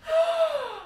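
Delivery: sharp high gasp of alarm; shocked gasp. Real, not acted. Mom finds the toilet overflowed, sharp gasp. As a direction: gasp at the flood.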